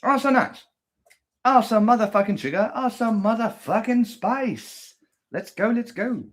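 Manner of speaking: high, hammed-up excited cries; mock excitement. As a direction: oversell every spoonful with glee. Hammed-up joy over yogurt.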